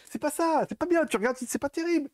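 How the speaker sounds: mock dismissive verdict. Beginner judging their first videos, high mock disapproval.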